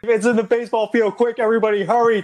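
high, loud urgent cartoon alarm; hammed-up frantic warning; panicked call. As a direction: rush everyone along in a high panicked voice.

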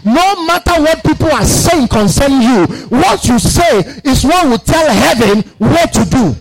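rising, shouted sermon declarations; fiery preaching. Acted. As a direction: preach it with building force. Preaching at full force.

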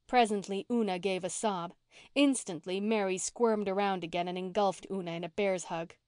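usual speaking voice

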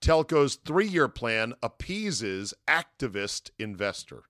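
slow, deliberate headline voice; announcer-style headline reading. Shown as a demonstration. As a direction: read it like a news banner.

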